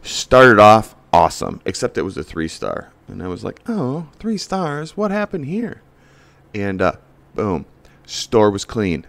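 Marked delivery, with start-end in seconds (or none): 3.7-5.8 mock surprise. Acting shocked at a review.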